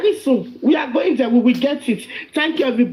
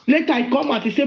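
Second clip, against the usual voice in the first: ordinary delivery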